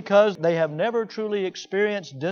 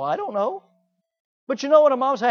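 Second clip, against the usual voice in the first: ordinary talk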